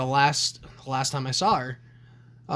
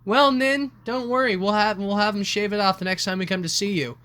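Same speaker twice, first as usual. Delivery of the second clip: put-on high, coaxing mother placating an old woman; mock-soothing mom voice; sweet-talking mother; 6 semitones higher, 4 dB louder than usual. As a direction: humor grandma sweetly.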